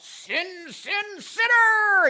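high, rising mock-preacher jab; mock a finger-pointing churchgoer; self-righteous accuser. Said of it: "get all self-righteous about it".

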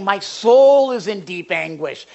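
loud, slow cry from the soul; deep anguish. Acted. Preacher voicing David's suffering, anguished. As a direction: lean hard and slowly into the anguish. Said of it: "'cause he's saying".

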